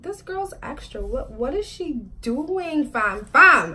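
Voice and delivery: high, baffled disbelief at an overreacting sister; what is she even doing, said high and slow; exasperated fam, fam; incredulous aside.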